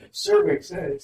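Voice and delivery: high announcer call of survey says; game show host. Shown as a demonstration. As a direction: call it like Family Feud. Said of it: "I sound like, uh, what's that called? Family Feud".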